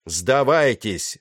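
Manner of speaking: loud, drawn-out demand to surrender; shout. A narrator's reading. Pirate leader to the surrounded sailors, shouting. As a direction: call it out slowly and forcefully. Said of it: "снова крикнул Серый".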